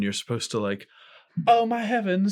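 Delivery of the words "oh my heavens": swooning lady. Retold or imitated. Acting a lady fainting on couch.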